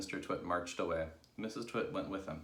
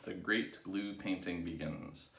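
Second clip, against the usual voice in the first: plain reading voice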